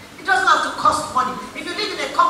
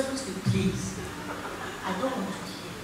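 a lower, much softer tired husband begging off; weary husband; 7 semitones lower, 13 dB quieter than usual. Retold or imitated. Preacher imitating husband begging his chatty wife to stop, lower and softer. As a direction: sigh the husband's plea to be left alone.

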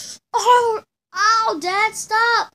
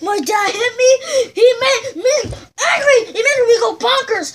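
high, loud wailing complaint; crying shout; 7 semitones higher, 7 dB louder than usual. Pretend. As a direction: pretend to cry out after being hit. Boy wails after dad hits.

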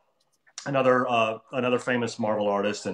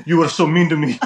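put-on wounded French-accented complaint, louder; Olivier Coipel; 3 semitones higher, 7 dB louder than usual. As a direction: imitate a hurt Frenchman scolding friends. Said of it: "he talks like this".

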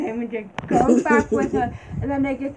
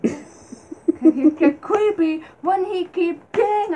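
laughing; 7 semitones higher, 4 dB louder, much slower than usual. Real, not acted. Laughing while telling.

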